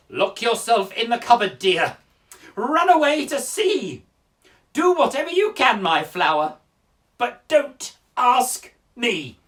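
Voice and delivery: very loud, high, slowing to a weighty finish; exasperated teacher. Acted. Teacher finally refusing to help the pupil, loud exasperation. Voices the teacher at the end of patience.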